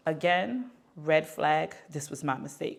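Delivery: ordinary delivery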